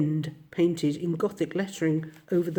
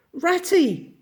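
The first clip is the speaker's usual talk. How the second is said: high, loud, eager cry across the road; bright excited shout of a name; joyful call; 12 semitones higher, 5 dB louder than usual. A narrator's reading. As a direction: call out to a friend with sudden joy. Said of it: "he called, full of joyful excitement".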